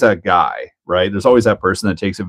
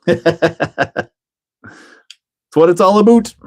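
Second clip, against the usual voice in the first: "about" said as "a boot", pitched high; thick Canadian accent; 9 semitones higher, slower than usual. Shown as a demonstration. Playing up his Canadian accent for the stream. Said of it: "I laid that one on pretty thick".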